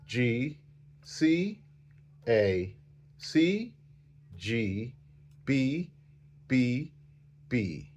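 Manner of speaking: soft, spaced-out note letters; slow note naming. Shown as a demonstration. Teacher showing how to finger while naming notes, slowly. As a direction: say each letter slowly and softly, pausing between. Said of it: "like this".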